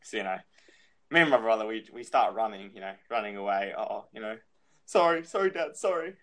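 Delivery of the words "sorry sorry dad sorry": higher-pitched mock apology to Dad; pleading child. Kid running from Dad, pleading. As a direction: plead lightly like a kid dodging a smack. Kids pleading with chasing father.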